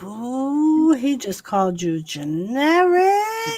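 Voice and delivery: drawn-out teasing ooh; slow, swooping mock-scandal. Real, not acted. Friend stirring over being called generic, teasing.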